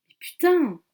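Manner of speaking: loud, high outburst of frustration; desperate curse. Acted. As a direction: spit the curse out in panic. Emergency call fails, desperate.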